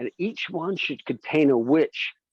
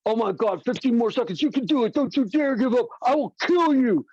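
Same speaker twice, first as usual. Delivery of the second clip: higher, pushy fitness coach yelling encouragement; aggressive boxing trainer; 8 semitones higher than usual. Retold or imitated. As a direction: hype them up like a drill-sergeant trainer. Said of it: "The boxing videos are like".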